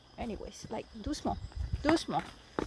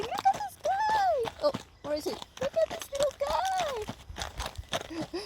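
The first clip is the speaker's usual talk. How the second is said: cooing pet talk. Real, not acted. Rider gushing at her little horse in baby talk.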